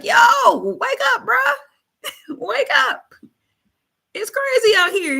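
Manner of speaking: scared friends shaking someone awake in a storm; panicked disciples. Retold or imitated. Panicked disciples voiced in Bible story.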